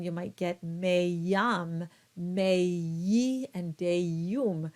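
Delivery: meyam, meyi, deyum with a Y slipped between vowels; wrong Y glide. Shown as a demonstration. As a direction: demonstrate the faulty glide. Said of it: "you've thrown in a Y in there".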